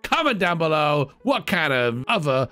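high, pumped YouTuber hype; hyped vlogger. Acted. Character vlogging from the beach shack, high and hyped. Mock YouTube channel outro.

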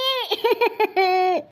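put-on wordless sobs; hammed-up crying noise; fake sobbing. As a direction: sob in pulses for show. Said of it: "கொஞ்சம் அழுவுற மாதிரி மூஞ்சி வச்சுக்கோ".

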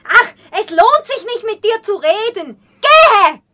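screaming at her suitor to leave; furious shout. Acted. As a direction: throw him out with a shout. She throws him out.

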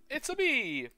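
high Mario-style catchphrase; mock Mario catchphrase. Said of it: "he's like".

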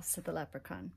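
ordinary delivery

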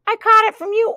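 high-pitched duck passing the blame; oinked accusation. A narrator's reading. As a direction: squeak the accusation. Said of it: "oinked the duck".

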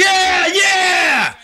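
high shout of joy; triumphant shout. Real, not acted. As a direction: yell it out after a big win. Big Keno win.

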